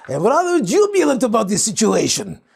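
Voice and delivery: mock-refined speaker showing off vocabulary; posh eloquent speaker. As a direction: sound grandly educated, a little pompous. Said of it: "You're speaking like this".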